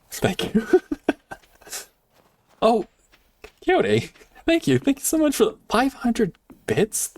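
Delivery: high, bright-pitched gushing thanks; excited thanks. Real, not acted. Streamer thanking Coyote for five hundred bits, excited. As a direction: gush over the donation.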